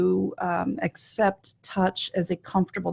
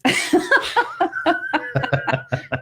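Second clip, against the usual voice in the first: real laugh. Real, not acted. Guest amused by host's Walmart remark, real laughter. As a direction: burst of high laughter.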